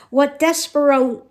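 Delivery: ordinary delivery, nothing marked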